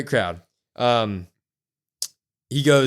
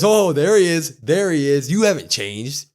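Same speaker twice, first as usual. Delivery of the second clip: gleeful taunt; 3 semitones higher, 6 dB louder than usual. Retold or imitated. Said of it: "Solo goes".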